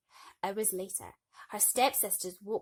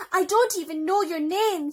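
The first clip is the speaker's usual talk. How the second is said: louder, higher, calling after her; desperate cry; 10 semitones higher, 13 dB louder than usual. Acted. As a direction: cry out as she runs away. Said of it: "he cried".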